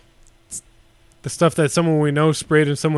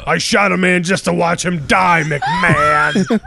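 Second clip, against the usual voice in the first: [name] voice; 2 semitones higher, 3 dB louder, slower than usual. Pretend. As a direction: build up to a yell on McMahon. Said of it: "Try [name] now".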